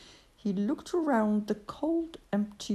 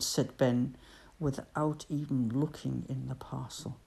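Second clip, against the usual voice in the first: usual speaking voice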